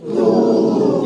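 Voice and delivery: तू said loud and breathy; teacher demonstrating the letter's sound; recited letter. Shown as a demonstration. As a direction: push out तू with a strong breath. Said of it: "ऐसे पढ़ेंगे तू".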